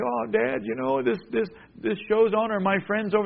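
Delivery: put-on whiny kid. Kids' excuse voiced.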